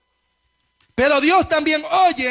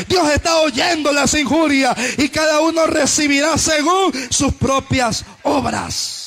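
loud, driving sermon delivery; impassioned preacher in full flow; full-force preaching; 4 semitones higher, 6 dB louder than usual. Real, not acted. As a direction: preach it hard, phrase after phrase, building to a long final cry.

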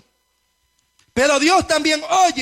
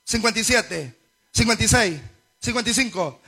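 ordinary delivery

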